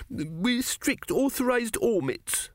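put-on high-pitched, slow boy's voice; small, tearful pious boy; earnest little Arthur. A narrator's reading. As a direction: declare it earnestly through the tears. Sobbing boy Arthur explains his religion.

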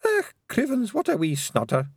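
Pixie voice. A narrator's reading. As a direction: mutter as a wee Feegle. Hidden Pixie comments.

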